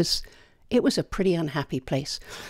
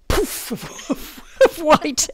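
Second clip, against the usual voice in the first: laughing through the words; 9 semitones higher, much slower than usual. Real, not acted. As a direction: break into a real laugh mid-phrase. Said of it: "It was hilarious".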